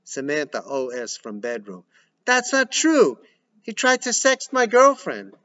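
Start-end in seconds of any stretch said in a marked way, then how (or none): 2.2-5.3 much higher, loud accusation; shouting. Acted.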